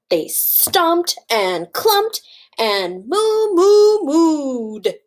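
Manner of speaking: slow, punched chant rising into loud moos; excited mooing. A narrator's reading. Reader acting out excited cows for children, loud mooing. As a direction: draw out high, loud moos.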